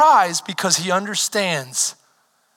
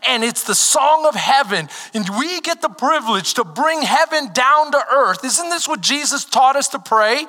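preaching, building higher and louder; fiery preaching; 8 semitones higher, 6 dB louder than usual. Acted. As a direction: lift the room, build to a shout. Preaching at full force, rising.

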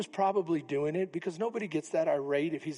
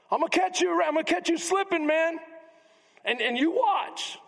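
street tough threat; 9 semitones higher, 4 dB louder than usual. Retold or imitated. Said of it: "Isn't that what guys do?".

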